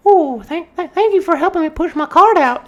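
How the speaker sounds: put-on very high, breathy-sounding old lady gush.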